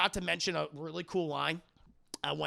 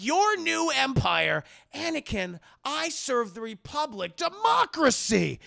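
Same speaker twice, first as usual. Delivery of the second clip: slow indignant voice; 7 semitones higher, 7 dB louder, slower than usual. Retold or imitated. Obi-Wan indignant, acted.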